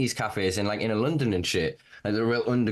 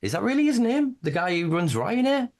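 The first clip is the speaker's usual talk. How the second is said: can't believe that's his real name; surprised disbelief; 10 semitones higher than usual. Real, not acted. Asking his cohost about the Ryanair boss's name, incredulous.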